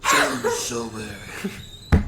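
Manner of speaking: very slow, stretched mock voice; dragged-out silly voice. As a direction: drag the words out in a put-on voice. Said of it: "Was that French?".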